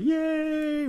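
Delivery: high squeaky cheer of joy; cheering yay. Retold or imitated. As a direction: Katara cheers at the poster, a high excited squeal. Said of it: "She's like".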